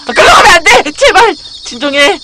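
high, loud frantic yelling; real in-game panic, voice shooting up; panicked shout. Real, not acted. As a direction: beg your teammate to stop right now. Partner makes a dangerous move.

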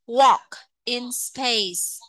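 very slow, each word drawn out; slow model reading. Shown as a demonstration. Teacher reading slowly for pupils to repeat. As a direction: read it slowly so the class can copy. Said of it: "Các bạn phát âm theo cô nha".

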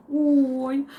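ordinary talk

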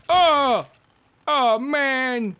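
loud high yelp of dismay; surprised cry. Real, not acted. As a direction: yelp as the head explodes. Character dies in game.

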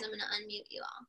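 ordinary delivery, nothing marked

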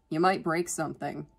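ordinary delivery, nothing marked

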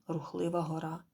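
ordinary delivery

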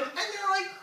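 ordinary talk, nothing marked

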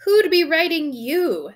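high, loud, slow sneer. A narrator's reading. Uncle scorns the boy's letter, sneering. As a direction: draw it out with scorn. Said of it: "sneered Uncle Vernon".

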